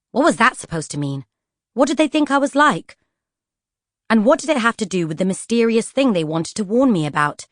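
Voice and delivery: usual speaking voice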